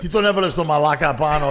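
loud, mock angry singer griping; mock angry complaint. The singer [name] complaining about the comedian, mock angry. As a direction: play the jealous singer complaining loudly. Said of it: "He goes".

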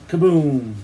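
loud imitated blast; booming falling kaboom; storyteller's sudden loud boom; bomb explosion. A narrator's reading. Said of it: "of the bombs".

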